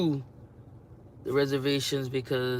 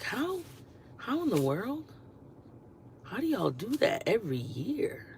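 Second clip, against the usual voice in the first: disbelief; 8 semitones higher, 5 dB quieter than usual. Real, not acted. Amazed at Buffalo snow.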